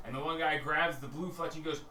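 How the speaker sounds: normal talking voice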